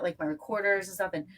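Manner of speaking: normal talking voice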